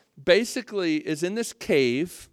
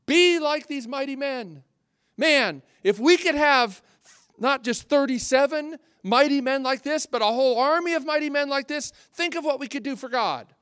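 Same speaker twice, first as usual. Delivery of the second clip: a hint of a fired-up preacher, higher and louder; mock rousing preacher; 7 semitones higher, 5 dB louder than usual. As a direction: ham up a rally cry for mighty men. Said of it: "People preach this passage saying".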